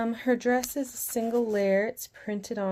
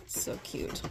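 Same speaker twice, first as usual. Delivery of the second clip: whispered aside; 8 dB quieter than usual. Real, not acted. Gushing over the doll.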